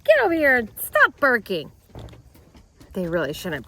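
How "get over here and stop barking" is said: loud call to the dogs. Real, not acted. Calling dogs in.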